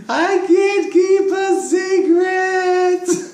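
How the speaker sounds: high, slow, wailing mock-cry; daughter's hammed-up wailing confession; imitated sobbing. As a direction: sob it out like a heartbroken little girl. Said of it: "she starts crying".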